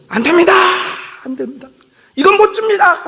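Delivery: high, loud panicked protest; desperate refusal. Retold or imitated. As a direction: cry out like a recruit clinging to his Bible. Recruit refusing to hand over Bible.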